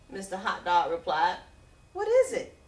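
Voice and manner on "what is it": eager question. Acted. Vendor asks about the smell.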